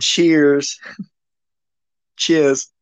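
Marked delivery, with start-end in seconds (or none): 2.2-2.7 mock British accent, r-less; exaggerated British accent. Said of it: "It's so exaggerated".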